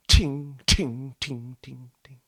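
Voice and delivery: blade clink. Shown as a demonstration. Blade drops on the altar.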